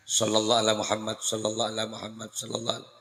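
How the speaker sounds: soft fast murmured sholawat; quick soft prayer murmur; quiet rapid recitation. Shown as a demonstration. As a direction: murmur it quickly under your breath. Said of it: "membaca sholawat Jibril".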